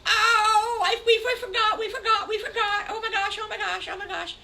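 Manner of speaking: very high, loud squeals with giggles; giddy shock. Acted. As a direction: squeal like kids who just remembered. Piglets remember their birthday.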